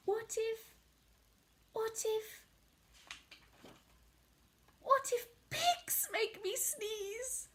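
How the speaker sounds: worried. Acted. Gerald worries.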